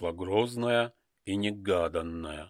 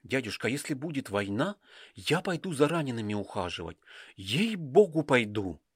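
put-on quick, high girl's voice, determined; a girl swearing she will go; eager young girl; 3 semitones higher than usual. Dasha's voice in the story. (горячий девичий голос)

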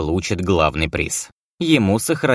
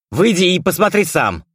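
louder, higher angry yell; angry shout; 11 semitones higher, 4 dB louder than usual. A narrator's reading. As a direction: shout back, openly angry. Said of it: "откровенно злясь, выкрикнул я".

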